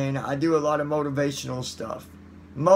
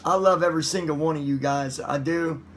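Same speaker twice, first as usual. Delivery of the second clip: ordinary talk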